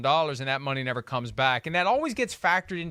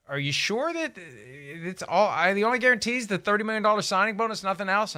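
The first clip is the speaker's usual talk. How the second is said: higher, rushed, incredulous questioning; retold disbelief; 5 semitones higher than usual. Reporter retelling his text to a source, incredulous. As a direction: relay a stunned double-check, pitched up.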